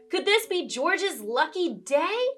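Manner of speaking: ordinary delivery, nothing marked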